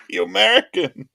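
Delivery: real surprise at his own mistake; laughing realisation. Real, not acted. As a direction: exclaim it half laughing. Realises misreading.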